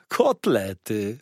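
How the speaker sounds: drawn-out waiter's exclamation; relishing exclamation. A narrator's reading. Waiter discovering the cutlets, drawn-out relish. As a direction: stretch the word out with relish.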